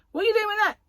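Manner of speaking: high, loud mock challenge; acted shout. Security man shouting after a shoplifter, acted shout. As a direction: shout it like you're chasing a thief. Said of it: "ran after me shouting".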